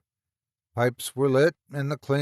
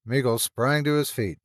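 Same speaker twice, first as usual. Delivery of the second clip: usual speaking voice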